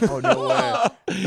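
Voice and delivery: high, very slow, laughing no way; amused disbelief. Retold or imitated. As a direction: stretch out the astonishment with a laugh. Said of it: "they were like".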